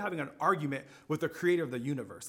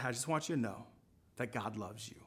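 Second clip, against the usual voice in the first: clearer repeat with a pause midway; paused, deliberate repeat; 5 semitones lower, 5 dB quieter than usual. Retold or imitated. Shopper repeating it for the cashier, paused and deliberate. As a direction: say it carefully, lowered voice, in two measured parts. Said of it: "So I paused".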